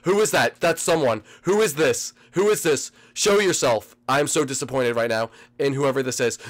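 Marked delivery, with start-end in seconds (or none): none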